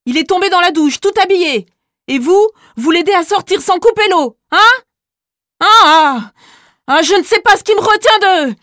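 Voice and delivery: put-on teacher screaming at the boys; furious yelling. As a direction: scream it at the bullies. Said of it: "Il hurle sur Julien".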